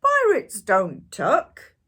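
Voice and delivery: very loud, slow booming refusal; bellowing. A narrator's reading. Captain refusing to tuck in the boy, loud slow boom. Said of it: "he bellowed".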